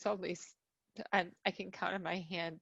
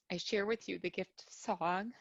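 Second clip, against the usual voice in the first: usual speaking voice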